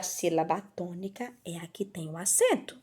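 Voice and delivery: soft, whispery aside to the pupil; hushed hint. Real, not acted. Teacher reminding a pupil of the accent rule, hushed. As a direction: drop into a near whisper.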